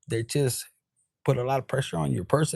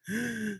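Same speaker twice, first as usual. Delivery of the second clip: high, soft mock gasp; acted little gasp; shocked gasp; 7 semitones higher, 8 dB quieter than usual. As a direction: suck in a breath as if shocked. Mimes their shock.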